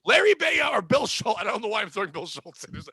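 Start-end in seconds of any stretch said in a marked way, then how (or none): 0.1-1.4 shouting out names; sudden loud outburst of names; loud blurt. Real, not acted. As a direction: yell the names for laughs.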